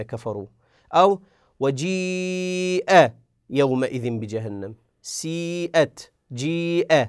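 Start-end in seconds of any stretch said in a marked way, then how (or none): 0.9-3.1 وجيء with the ee drawn out long before the hamza; slow recited lengthening; long madd on ya. Shown as a demonstration. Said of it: "مثال الياء". 5.0-6.1 سيئت with the ii stretched before the hamza; slow Quranic stretch; long madd on ya. Shown as a demonstration. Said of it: "مثال الياء نقول سيئت".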